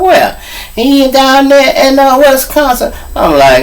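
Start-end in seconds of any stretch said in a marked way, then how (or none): 0.0-0.4 high, loud questioning; startled disbelief. Retold or imitated. Friend hearing of a murder charge, startled disbelief. 0.7-2.9 high, loud panicky mother; imitated panic. Mother telling her son's friend where he is, high imitated panic. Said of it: "and she's panicking".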